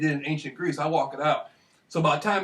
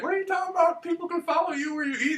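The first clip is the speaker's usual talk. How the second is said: much higher, incredulous mock voice of the clerk; imitating the clerk; 11 semitones higher than usual. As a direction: squeak it out like a baffled police clerk. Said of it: "the woman working there is like".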